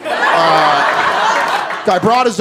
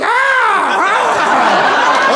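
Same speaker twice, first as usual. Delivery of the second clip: high, hammed-up cries of fake pain; mock pained yelps; 8 semitones higher, much slower than usual. Mocking the robber's weak hit with fake cries. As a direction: ham it up like a wrestler faking the hit. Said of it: "He hit me, I was like".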